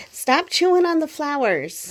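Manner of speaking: playful scolding. Real, not acted. Scolding pet chewing flowers.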